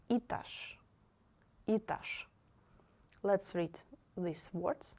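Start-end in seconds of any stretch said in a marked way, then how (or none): 0.1-2.3 Этаж said itazh, first vowel и; etazh with и. Shown as a demonstration. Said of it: "you read it like И".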